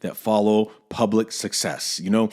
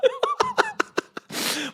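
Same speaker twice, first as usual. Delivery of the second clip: long, high burst of laughter; 3 dB quieter than usual. Real, not acted. Laughs at own awkward admission.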